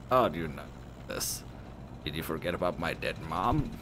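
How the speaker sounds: low, soft, bitter disbelief; hurt friend. Acted. Bereaved friend to drunk girl, low hurt reproach. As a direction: keep it quiet and wounded.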